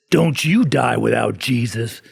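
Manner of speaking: slow, emphatic mock holler; retold old-man scream. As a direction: imitate an old man's warning cry, drawn out. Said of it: "this old man's screaming this in Safeway".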